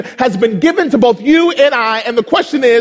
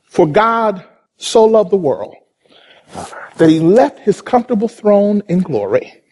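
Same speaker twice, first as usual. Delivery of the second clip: ordinary talk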